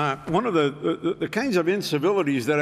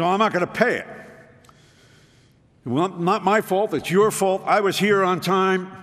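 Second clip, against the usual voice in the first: raised, higher-pitched indignant retort; retelling his own argument with the clerk, indignant and raised; indignant protest; 5 semitones higher than usual. Retold or imitated. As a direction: insist you were on time, riled up. Arguing with the rental clerk.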